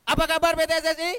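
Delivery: loud, fast rally call to the crowd; energetic shout. Shown as a demonstration. Speaker testing the crowd's energy, loud fast greeting shout. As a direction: shout the greeting to fire them up. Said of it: "Coba saya tes".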